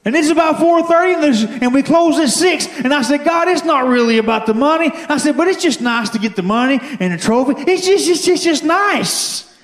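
high pulpit pitch, last word stretched out; impassioned preaching. Real, not acted. Preacher retells his plea to God for a sale, impassioned preaching. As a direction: plead your case with God in a preacher's cry. Preaches testimony at full force.

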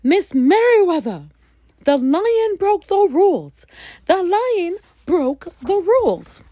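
loud, high urgent shouting; calling out. Acted. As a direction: yell it down the hall. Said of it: "he called as he walked".

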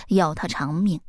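usual speaking voice

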